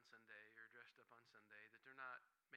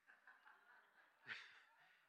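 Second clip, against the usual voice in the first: nervous laugh; 7 dB quieter than usual. Real, not acted.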